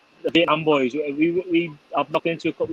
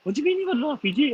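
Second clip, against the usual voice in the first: put-on very high, fast childlike astonishment; amazed son; 14 semitones higher, faster than usual. Son can't believe dad got an RPG fired at him, very high fast kid voice. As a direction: rattle it off like an excited kid. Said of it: "He just went".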